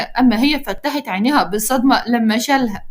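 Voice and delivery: plain reading voice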